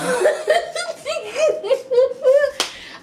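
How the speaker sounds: long high laugh; burst of laughter. Real, not acted.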